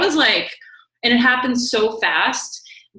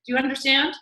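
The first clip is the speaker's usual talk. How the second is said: normal talking voice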